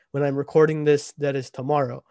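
ordinary delivery

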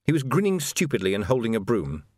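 ordinary delivery

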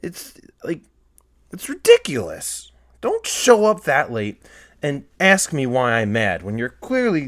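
high-pitched, exasperated scolding; worked-up rant. Real, not acted. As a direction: scold the latecomer in fed-up disbelief. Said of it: "I can really go off the rails".

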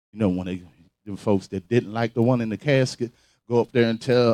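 quiet aside. Real, not acted.

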